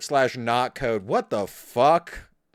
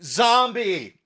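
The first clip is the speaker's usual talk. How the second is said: laughing mock alert; 4 semitones higher than usual. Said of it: "you go".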